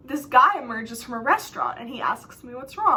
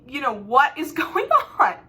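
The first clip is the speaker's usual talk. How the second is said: shocked; 5 dB louder than usual. Retold or imitated. Confronting cousin on FaceTime about being there, shocked. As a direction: act out your baffled disbelief, drawing out the question. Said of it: "So I'm shocked".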